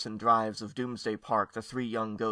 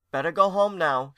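a touch louder call; mother calling out to the little ghost; 6 semitones higher, 7 dB louder than usual. Acted. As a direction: call across the room to the child. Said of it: "she called out again".

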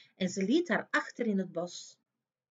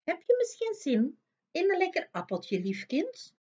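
put-on higher, sly sweet old woman; old apple woman; 8 semitones higher than usual. A narrator's reading. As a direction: wheedle like a disguised witch. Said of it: "Een oud appelvrouwtje vroeg".

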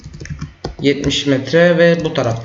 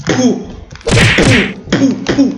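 mock shooting noises; 7 semitones higher, much slower than usual. Imitating shooting sounds.

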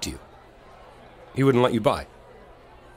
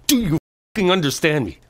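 louder, higher snap; angry demand; 5 semitones higher, 5 dB louder than usual. Acted. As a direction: drive the threat home. Threat escalates to demand.

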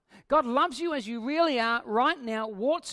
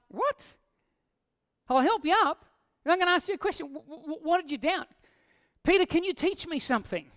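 mock clueless Jesus; 7 semitones higher than usual. Preacher mocking a Jesus asking Peter, hammed-up high voice. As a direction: send up the idea of a Jesus who needs answers. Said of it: "And I'm like".

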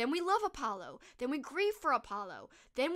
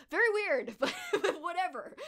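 higher, louder, through laughter; amused, breaking into a laugh; 6 semitones higher, 5 dB louder than usual. Real, not acted. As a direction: laugh while dismissing it. Laughing at the robot romance.

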